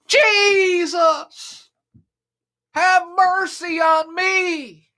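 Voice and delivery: high, loud, drawn-out plea; pleading shout. Acted. Blind beggar begging Jesus for mercy, loud and slow. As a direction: beg at the top of your voice, slowly.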